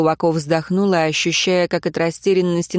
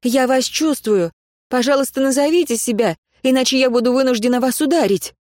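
raised, firm warning voice; said loudly; 6 semitones higher than usual. Acted. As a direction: call out firmly, higher. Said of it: "громко сказала".

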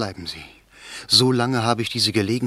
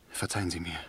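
much softer, begging forgiveness; soft plea; 6 semitones lower, 12 dB quieter, much faster than usual. Acted. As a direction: ask to be forgiven, barely above a breath. Lover begs the lady's forgiveness. (leise und flehend um Verzeihung bitten)

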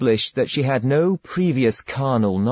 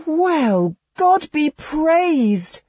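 put-on much higher, slow, exclaiming girl voice; girl crying out; much slower than usual. A narrator's reading. Girl thanks God she lacks the devil, high drawn-out exclaim. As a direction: draw out the praise in a high innocent voice. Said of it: "cried the girl".

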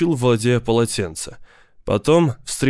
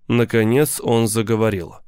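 usual speaking voice

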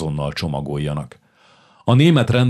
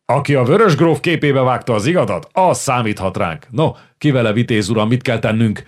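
rousing call; 5 semitones higher, 4 dB louder than usual. Acted. Csupor slams table, rallies.

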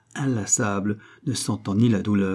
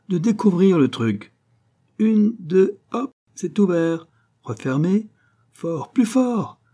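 high-pitched, exuberant hup-two commands; excited boasting; 9 semitones higher, 5 dB louder, slower than usual. A narrator's reading. Old locksmith drilling Malouin on the door, excited. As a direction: crow and urge him to push harder.

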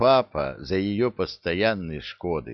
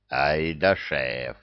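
envious sigh; 6 semitones lower, much slower than usual. A narrator's reading. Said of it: "Завистливо вздохнул Зырк".

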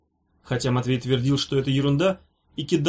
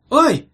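high, loud cry of "ow"; sharp startled ouch; pained yelp; 12 semitones higher, 9 dB louder than usual. A narrator's reading. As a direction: yelp as if suddenly poked. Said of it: "воскликнул Йогурт".

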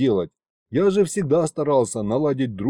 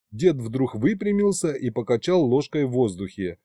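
ordinary delivery

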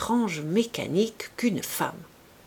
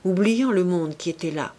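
plain reading voice

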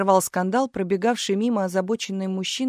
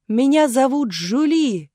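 high, loud, slow stage declamation; full-force child's cry; 6 semitones higher, 5 dB louder, slower than usual. A narrator's reading. Little actress introducing herself to Marat, crying out. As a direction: call it out slowly to the whole hall. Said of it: "что есть силы крикнула Галя".

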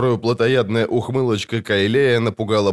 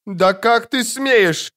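high, slow outraged wail; wailing outcry; 11 semitones higher, much slower than usual. A narrator's reading. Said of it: "завопила Матрона".

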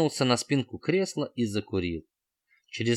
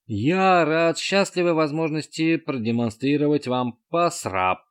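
higher, louder robot voice; metallic robot voice; 5 semitones higher, 4 dB louder than usual. A narrator's reading. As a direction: announce it like a machine. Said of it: "вещал железный стукан".

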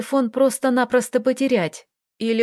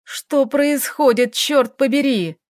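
отчаянный выкрик; 4 semitones higher, 3 dB louder, slower than usual. Acted. Роман в отчаянии кричит.

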